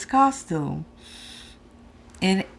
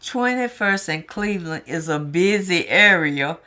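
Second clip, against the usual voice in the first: normal talking voice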